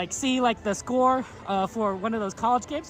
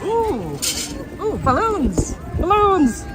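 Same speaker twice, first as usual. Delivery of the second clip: high, loud delighted coo over balloons; light up at the sight; 8 semitones higher, 5 dB louder, much slower than usual. Real, not acted. Streamer spotting balloons, delighted and loud.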